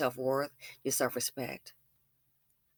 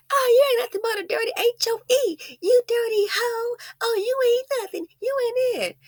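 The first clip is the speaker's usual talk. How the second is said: much higher, mocking put-on voice of a catty woman; mock trash talk; 8 dB louder than usual. Mimicking a woman who trades insults. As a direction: sneer it in a high, nasty put-on voice.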